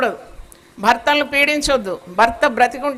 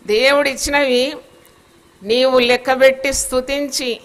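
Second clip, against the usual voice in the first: ordinary talk, nothing marked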